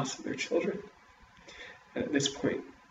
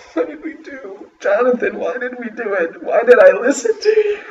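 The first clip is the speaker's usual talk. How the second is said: high, loud, tearful reproach; weeping mother; 11 semitones higher, 10 dB louder than usual. A narrator's reading. Mother crying to Jonathan over what they did, high and tearful. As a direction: blame him through sobs.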